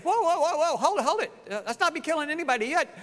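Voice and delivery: high, fast, laughing panic; comic high-pitched panic, laughing through it; panicked Abraham. Acted. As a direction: backpedal in a nervous, laughing rush. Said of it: "I'm sure Abraham's saying".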